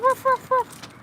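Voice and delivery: put-on high, playful dog barks for the baby; high playful barking. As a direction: bark like a little dog to amuse him.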